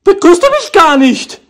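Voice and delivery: high coaxing voice to a pet; talking to a dog. Real, not acted. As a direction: coo at the dog, hurt.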